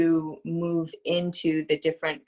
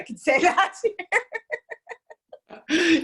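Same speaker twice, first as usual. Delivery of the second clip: laughing; 12 semitones higher, 3 dB louder than usual. Real, not acted. Laughs at swearing on air.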